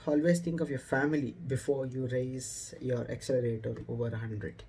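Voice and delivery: ordinary talk, nothing marked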